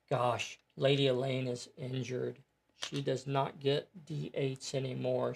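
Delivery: ordinary talk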